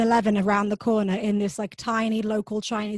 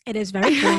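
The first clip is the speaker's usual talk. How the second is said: louder, laughing through it; 6 dB louder, faster than usual. Real, not acted. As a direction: laugh as you say it. Laughing about warm wine.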